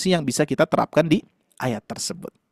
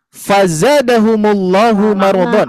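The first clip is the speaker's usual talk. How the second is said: slow Quran recitation; 2 semitones higher than usual. Shown as a demonstration. Teacher reciting the verse to parents, slow and melodic. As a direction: chant it slowly and melodically.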